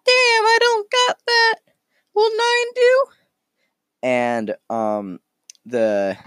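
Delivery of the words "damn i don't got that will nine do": very high squeaky critter voice; Space Squirrel. Acted. Squirrel haggling with the innkeep, very high squeaky voice. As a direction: squeak it out loudly, like a little cartoon animal. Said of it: "and Space Squirrel goes".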